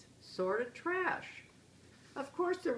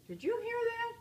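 much higher, louder amazed question; 14 semitones higher, 4 dB louder than usual. Acted. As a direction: sell the shock of the price. Papa amazed by the price.

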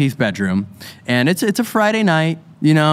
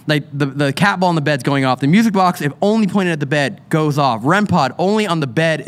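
ordinary talk

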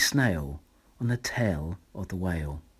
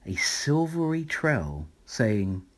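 ordinary delivery

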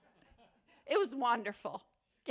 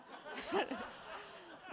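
breathy laugh; 6 semitones higher, 8 dB quieter than usual. Real, not acted. Laughs at own joke.

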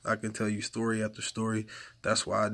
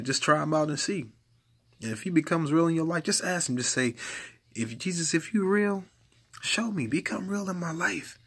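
higher pleading preaching; fervent preaching; 7 semitones higher than usual. Acted. Preacher modelling a prayer for listeners, higher and pleading. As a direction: lift it up and plead.

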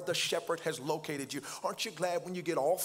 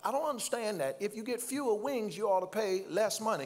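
usual speaking voice

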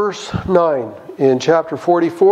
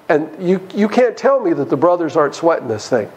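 ordinary delivery

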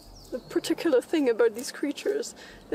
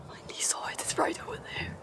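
excited hushed whisper; 8 dB quieter, faster than usual. Acted. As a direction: whisper so the animal won't hear. Sneaking up on creature.

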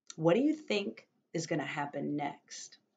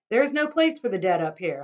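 louder, fast protest; loud scolding; 3 semitones higher, 9 dB louder, faster than usual. Acted. Grandfather scolding the cart man, loud fast protest. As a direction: warn him off, loudly.

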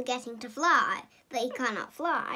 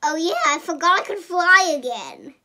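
loud realisation; 3 semitones higher, 9 dB louder than usual. Acted. Hero realises mid-fall.

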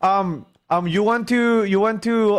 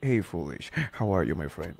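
very low, hushed grown-up voice; deep, subdued, even-toned friend; Bobby; 11 dB quieter than usual. Acted. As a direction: drop deep and quiet for a soft-spoken greeting. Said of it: "But sometimes Bobby's like".

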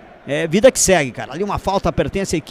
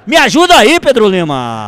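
shouted, much louder and higher; goal-call shout; 6 semitones higher, 10 dB louder than usual. Real, not acted. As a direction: yell it still riding the goal. Calling partner after goal.

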